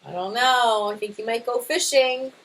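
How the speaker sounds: ordinary delivery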